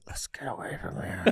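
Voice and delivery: whispered aside to a friend; retold whisper. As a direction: hush it like sneaking off. Retold escape, unvoiced.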